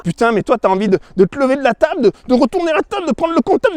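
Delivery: building anger. Retold or imitated. Rising anger at accountant.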